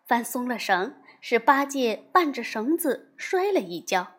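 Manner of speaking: usual speaking voice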